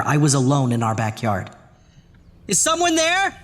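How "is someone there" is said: high nervous call; uneasy call. Acted. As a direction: call out unsure. Calling into empty yard.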